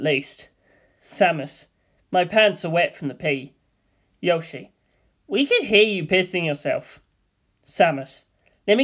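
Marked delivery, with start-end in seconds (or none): none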